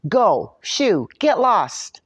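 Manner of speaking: loud shooing; shouted. A narrator's reading. Leprechaun shouting at the dog to leave. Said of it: "I shouted".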